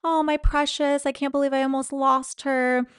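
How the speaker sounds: mock-weepy husband pleading to anyone listening; mock wailing husband. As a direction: ham up the clingy husband's fake grief. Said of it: "he's like".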